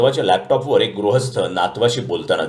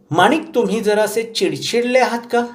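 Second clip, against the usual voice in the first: much higher granny voice, insistent; old grandmother; 13 semitones higher than usual. A narrator's reading. Grandmother telling grandson she wants to talk, insistent. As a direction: insist like a determined old lady.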